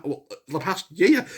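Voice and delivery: ordinary talk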